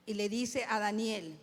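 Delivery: ordinary talk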